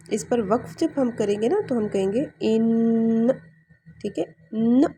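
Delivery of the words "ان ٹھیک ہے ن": ان closed firmly on the ن; ان with waqf on the ن; stop on noon. Shown as a demonstration. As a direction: pause on ان with a clear nasal stop. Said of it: "اس طرح سے آواز آئے گی".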